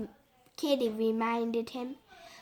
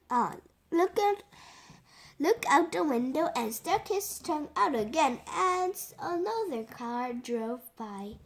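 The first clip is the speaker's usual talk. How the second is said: ordinary delivery, nothing marked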